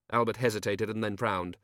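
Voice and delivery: plain reading voice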